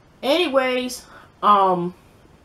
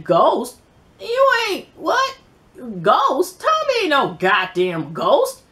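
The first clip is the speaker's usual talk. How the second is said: much higher, loud incredulous "what?", then slow scorn; mocking disbelief; 5 semitones higher, 3 dB louder, slower than usual. Holly mocks Angie for calling Tommy Ghost, in loud disbelief. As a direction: scoff like she can't believe the name.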